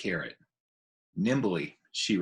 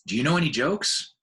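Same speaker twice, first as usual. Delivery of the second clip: put-on higher, louder child's plea; eager little elephant; 5 semitones higher, 4 dB louder than usual. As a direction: beg for a joke like a child. Ruby asks for jokes.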